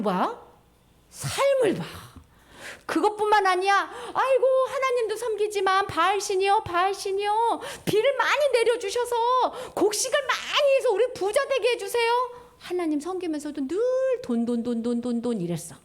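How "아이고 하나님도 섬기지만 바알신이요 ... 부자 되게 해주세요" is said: higher, mock-begging plea; pleading prayer. Lightly hammed-up villagers pleading to Baal. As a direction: beg Baal for rain and riches.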